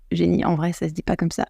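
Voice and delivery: softly spoken, gentle aside; boyfriend's discreet correction. Retold or imitated. English boyfriend correcting Jenny's pronunciation, soft discreet correction. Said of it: "mon copain anglais de l'époque m'a dit".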